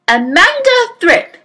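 high, loud headmistress shout. A narrator's reading. Trunchbull calling Amanda Thripp over, shouting. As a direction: bellow the name across the playground. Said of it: "she was shouting".

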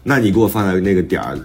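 ordinary talk

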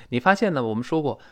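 ordinary talk, nothing marked

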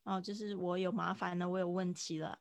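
ordinary delivery

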